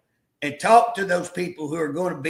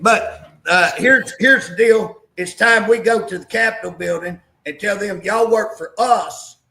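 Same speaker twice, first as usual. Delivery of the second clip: louder, rousing rally cry; fired-up; 3 semitones higher, 7 dB louder than usual. Real, not acted. Guest urging grassroots people to confront lawmakers, loud rally cry.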